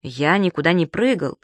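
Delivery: usual speaking voice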